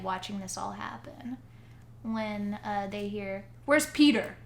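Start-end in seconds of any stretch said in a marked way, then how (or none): 3.7-4.4 raised, louder officer's call. Retold or imitated. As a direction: call out for the missing boy. Officer calls out for a boy.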